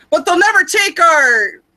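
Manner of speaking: loud, high rallying cry; mock-heroic war speech climax; William Wallace. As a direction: build to a defiant battlefield yell. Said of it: "my best interpretation of William Wallace".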